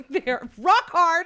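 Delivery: loud, high, drawn-out proclamation; mock indignant boast. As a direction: belt it out as a joking rebuttal. Joke boast rebutting inverted nipples claim.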